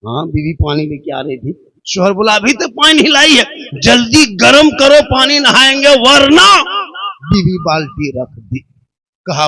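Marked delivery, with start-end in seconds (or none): 2.4-6.5 bossy order. Acted. Husband bossily orders his wife.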